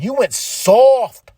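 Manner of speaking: slow, high, loud taunting; sneering stable taunting; mock heel sneer. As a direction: spell it out to mock him. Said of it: "they're gonna be like".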